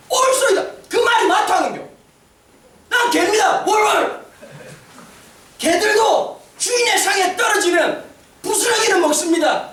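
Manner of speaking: humble pleading answer. Retold or imitated. Foreign woman answering Jesus, humble bold plea. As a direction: beg humbly but boldly. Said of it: "이 여자가 뭐라고 하냐니까".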